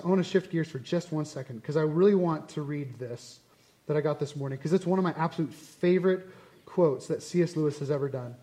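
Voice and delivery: ordinary talk, nothing marked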